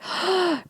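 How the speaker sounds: quick, high gasping inhale; sharp breath in. Retold or imitated. Worker sitting down to breathe at work, sharp gasp in. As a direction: gasp in air as if struggling to breathe. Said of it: "breathe in".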